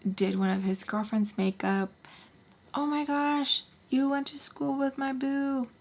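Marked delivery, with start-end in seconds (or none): 2.8-5.7 high, sing-song imitation of a girlfriend; gushing girlfriend. Girlfriend telling the makeup artist about her boo, gushing. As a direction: mimic a sweetly oblivious girlfriend up high.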